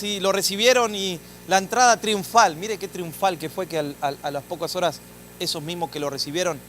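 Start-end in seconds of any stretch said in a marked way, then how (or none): none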